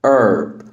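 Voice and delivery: "Herb" as "erb", H dropped; American "Herb" without the H; silent H. Shown as a demonstration. Teacher models American accent, "Herb" with silent H. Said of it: "ال H هنا silent".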